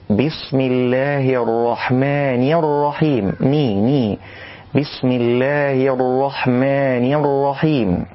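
الرحمن with a crisp ni, slow; clear kasra nun. Shown as a demonstration. Teacher recites the correct nun. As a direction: give الرحمن a clean ni. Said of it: "والصواب تحقيق الكسرة في النون".

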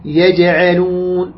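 يجعلون slowly, with the jim bounced; qalqala. Shown as a demonstration. Teacher models the letter for students, slow qalqala bounce. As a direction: echo the still ج in يجعلون, long final syllable. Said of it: "تقلقل، زين".

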